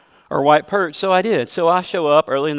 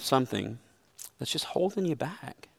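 soft take; 11 dB quieter than usual. Shown as a demonstration. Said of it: "repeated line, first take soft".

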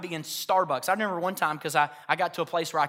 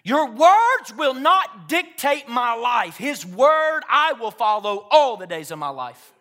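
loud, slow, high-pitched proclamation; defiant declaration; 5 semitones higher, 7 dB louder, slower than usual. Retold or imitated. Preacher telling the enemy whose word he follows, loud defiance. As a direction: shout it down at the enemy, stretching the words. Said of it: "That's what I began to say to him".

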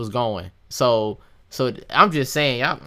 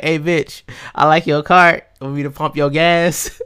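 loud mock pickup line, laughing into it; crude pickup line; 2 semitones higher, 7 dB louder, slower than usual. Joking to friends about hitting on a girl at the pump. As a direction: overdo the crude come-on for laughs.

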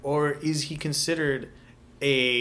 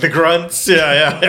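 loud, punchy echo of the word; loud mocking repeat; 4 semitones higher, 10 dB louder, slower than usual. Real, not acted. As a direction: call it out loud, teasing.

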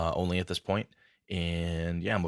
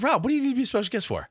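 mock incredulous; 6 dB louder, faster than usual. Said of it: "I'm like".

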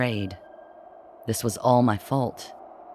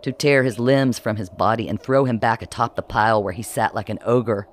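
ordinary delivery, nothing marked

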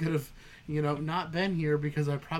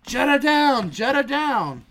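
high, loud mock alarm call; hollered man-down warning, imitated; imitated scream; 9 semitones higher, 8 dB louder than usual. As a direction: scream it like an emergency alert. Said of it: "they're just gonna scream".

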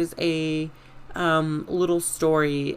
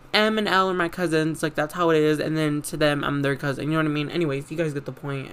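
normal talking voice

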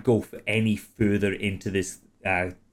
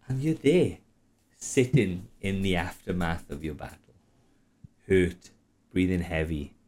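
closing narration; 5 semitones lower, 4 dB quieter than usual. A narrator's reading. Game master closing the night's scene. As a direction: settle the scene down gently.

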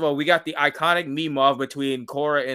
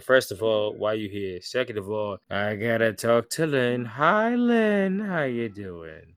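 annoyed Tenzin; 4 semitones lower, slower than usual. Retold or imitated. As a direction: imitate an irked man, slow and low, switching pitch to say hi. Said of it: "Tenzin is hella annoyed".

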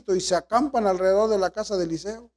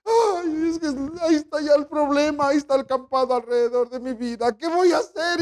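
acted panic; 4 semitones higher than usual. Preacher voices believers' panic.

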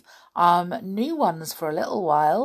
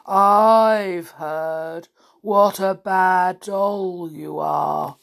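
slow, drawn-out hollow menace; deep, hollow voice; 4 dB louder, much slower than usual. Acted. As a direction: intone it like a bogeyman on the telephone. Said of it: "a deep, hollow voice came to her ear".

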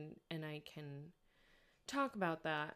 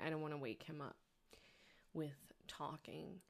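hushed, trailing into a whisper; quiet at night; 7 dB quieter, faster than usual. Real, not acted. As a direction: keep it down so nobody wakes. Husband is sleeping, the walls are thin.

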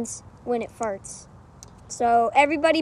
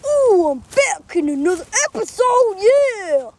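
higher, louder goofy Donkey Kong; 6 semitones higher, 6 dB louder than usual. Acted. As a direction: burst in as a loud cheerful ape character. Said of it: "And Donkey Kong".

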